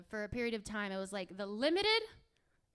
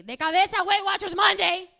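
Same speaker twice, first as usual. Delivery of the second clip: loud, high bilingual order; mother yelling a command; shouting mom; 9 semitones higher, 13 dB louder than usual. Acted. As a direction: holler it as a final verdict.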